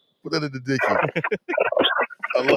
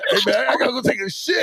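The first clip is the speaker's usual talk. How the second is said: little kindergartner; 6 semitones higher than usual. Retold or imitated. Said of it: "little Jason in kindergarten".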